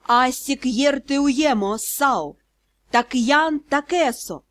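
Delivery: ordinary delivery, nothing marked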